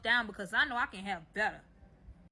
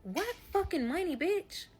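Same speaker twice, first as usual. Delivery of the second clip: baffled disbelief; 5 semitones higher, 3 dB quieter than usual. Retold or imitated. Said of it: "looking crazy as fuck, like".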